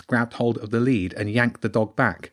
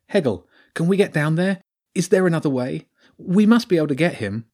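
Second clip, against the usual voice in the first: raised, anxious questioning; urgent pleading; 6 semitones higher, 3 dB louder than usual. Acted. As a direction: plead urgently for a way to rescue him. Dog lost down the drain.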